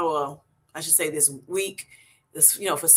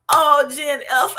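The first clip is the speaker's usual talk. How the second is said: excited squeal; 8 semitones higher, 7 dB louder, slower than usual. Real, not acted. Thrilled by a viewer's super chat.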